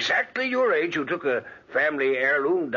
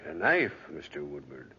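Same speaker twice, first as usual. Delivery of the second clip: low, ominous; 10 semitones lower, 5 dB quieter than usual. Acted. Guest lingers ominously on knife.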